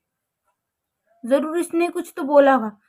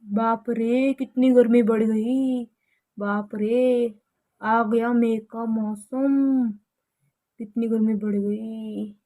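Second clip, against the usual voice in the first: lower, softer, slow weary sighing; father coming home exhausted by the May heat; tired from the heat; 6 semitones lower, 7 dB quieter, slower than usual. Acted. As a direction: drag the words out, wilting. Father complaining about heat, tired.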